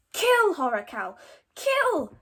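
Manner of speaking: loud eager command; Fishlegs urging. Acted. Fishlegs urging his dragon to kill, loud. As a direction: egg the bird on to attack. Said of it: "said Fishlegs to Horror Cow".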